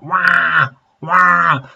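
mock bawling after the camel ride; imitated wailing. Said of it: "it just started wailing".

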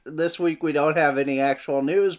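usual speaking voice

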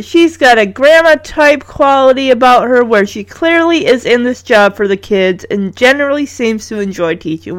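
ordinary delivery

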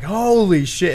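a sudden retold holy shit; shocked exclamation. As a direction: blurt it out as the plane drops away. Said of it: "And I was like".

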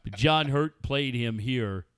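ordinary talk, nothing marked

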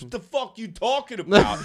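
put-on high, loud tough-kid retort; mock angry challenge. Karate kid turns on his heckler, mock-angry challenge. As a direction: imitate an offended kid squaring up. Retold kid angrily confronts speaker.

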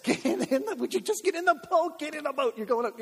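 high, strained mock exasperation; hammed-up insistence. Jesus pushing disciples into the boat, comic high-pitched insisting. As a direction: plead and order in a squeaky, worked-up voice. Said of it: "And he insists".

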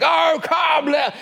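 high, bellowed carol line; football terrace shout. Shown as a demonstration. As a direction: belt it out like a fan on the terraces. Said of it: "on the football terrace and you're shouting".

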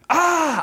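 put-on high-pitched baby protest cry; imitated one-year-old's yell; wordless toddler squawk. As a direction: squawk like a baby claiming a toy. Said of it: "Zoe can't speak yet, so she just goes".

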